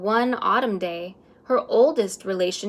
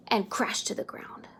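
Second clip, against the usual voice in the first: sudden whisper; 6 dB quieter than usual. A narrator's reading. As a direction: drop to a hushed whisper. The crash after suspense.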